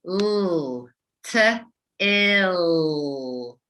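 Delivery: "t, ill" split into separate sounds; sound segmenting. Shown as a demonstration. Teacher segmenting the sounds for readers. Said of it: "what's the next sound you hear?".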